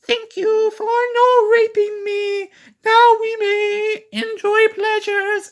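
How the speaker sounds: very high, slow falsetto lady; over-the-top high woman voice; mock woman voice. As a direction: squeak it out like a hammed-up movie seductress. Mocking the woman character's lines.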